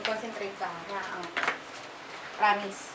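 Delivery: hushed ritual voice. Acted. Leading a levitation ritual.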